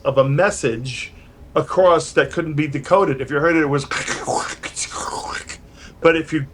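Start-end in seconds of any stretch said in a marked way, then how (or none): 3.9-5.5 garbled whispered noise. Retold or imitated. Imitating garbled wartime signal.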